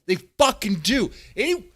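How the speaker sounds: worked-up, high-pitched angry rant; heated, incredulous tirade; getting heated. Real, not acted. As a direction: rant in real outrage, voice climbing. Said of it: "I'm getting fucking heated".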